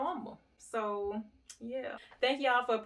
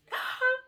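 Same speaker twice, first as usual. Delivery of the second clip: much higher, louder gasp of shock; sharp high gasp; 6 dB louder than usual. Retold or imitated. Owner seeing her lost dog return, gasping loudly. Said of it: "And I was like".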